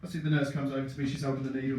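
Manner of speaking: normal talking voice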